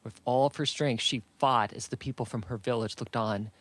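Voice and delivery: ordinary delivery, nothing marked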